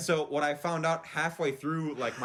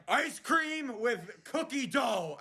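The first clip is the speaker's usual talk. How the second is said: slow, much higher mock yell with a laugh; mock shout; 8 semitones higher, much slower than usual. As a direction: bark each word out slowly. Impatient customer repeating his order.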